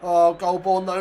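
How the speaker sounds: ordinary talk, nothing marked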